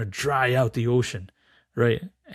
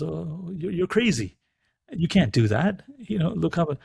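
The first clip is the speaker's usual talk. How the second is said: lightly acted objecting father bird; higher-pitched protest from a bird; 6 semitones higher than usual. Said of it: "the father bird says".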